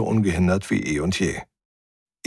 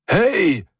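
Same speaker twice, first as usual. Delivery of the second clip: high, louder call from behind a thick door; calling through steel; 12 semitones higher, 4 dB louder than usual. A narrator's reading. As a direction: call out to be heard through the wall. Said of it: "vernahm ich Huberts Stimme ... fast bis zur Unhörbarkeit gedämpft". (durch die Stahltür rufend, hoch)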